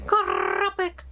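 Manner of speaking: high, soft mock turkey grubbit; squeaky attempt at a turkey sound; turkey noise attempt. Shown as a demonstration. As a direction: try out a turkey call, unsure. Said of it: "Let's see if I remember what he told me".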